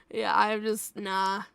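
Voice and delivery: usual speaking voice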